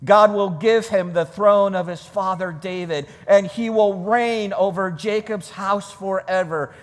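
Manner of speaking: usual speaking voice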